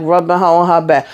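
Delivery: ordinary talk, nothing marked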